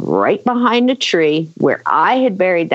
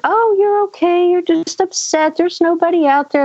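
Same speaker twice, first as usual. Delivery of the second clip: high-pitched mock-soothing mom; singsong, higher voice, as if everything is fine; breezily dismissive mom; 10 semitones higher than usual. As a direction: imitate a mother brushing off a scared kid. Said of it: "like everything is fine".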